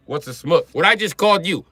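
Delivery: ordinary delivery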